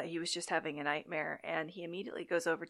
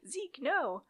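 high, whiny mock pleading; mocking sleep-talk; 9 semitones higher than usual. Budnick teasing Michael about talking in his sleep, high mocking whine. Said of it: "starts making fun of him".

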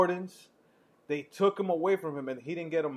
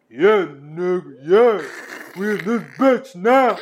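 loud, slow, higher swaggering inmate call; menacing inmate; 3 semitones higher, 12 dB louder, slower than usual. Retold or imitated. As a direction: drag it out like you own the room. Voicing prisoners entering.

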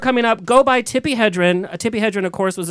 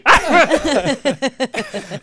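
sputtering mom; 6 semitones higher, much slower than usual. Retold or imitated. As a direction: splutter so angry the words won't come. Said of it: "the window going up and down".

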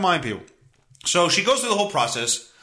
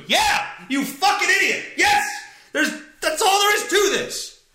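furious yell; 12 semitones higher than usual. Retold or imitated. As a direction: blow up at the clerk. Retold outburst at billing clerk.

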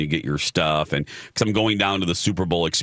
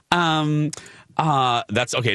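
put-on high, airy Valley Girl drawl; 7 semitones higher, slower than usual. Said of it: "That's, that's my Valley Girl voice".

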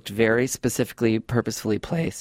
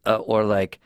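ordinary delivery

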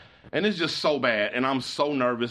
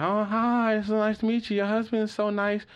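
put-on drunk woman greeting; 10 semitones higher than usual. Drunk guest greets the wife.

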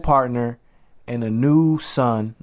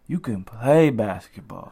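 slow, drawn-out athlete impression; Deontay Wilder; 3 semitones lower than usual. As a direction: stretch it out slowly, mimicking a boxer. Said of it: "What my man Deontay Wilder say?".

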